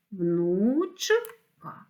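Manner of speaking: "внучка" in two drawn-out parts, внуч-ка; split into syllables. Shown as a demonstration. Teacher dividing a word for pupils, "внучка" syllable by syllable. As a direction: break "внучка" with a clear gap: внуч, ка. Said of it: "слова на склади".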